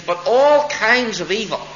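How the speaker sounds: rising preacher's emphasis; emphatic stress. Real, not acted. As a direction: drive the word "all" home.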